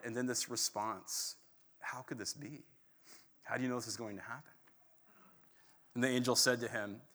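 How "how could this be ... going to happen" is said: hushed and softer, the second half rushed; fearful doubt. Retold or imitated. Zechariah answering the angel, hushed and afraid. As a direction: shrink back in frightened doubt. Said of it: "overwhelmed by fear, terrified".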